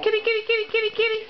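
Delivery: high, lilting call for the cat; sing-song kitty kitty, high and light. Real, not acted. Calling the cat to come.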